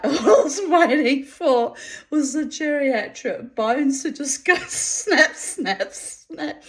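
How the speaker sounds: helpless giggles, high-pitched; laughing. Real, not acted. Joking about bones snapping in a love scene, laughing. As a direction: laugh through the snapping noises.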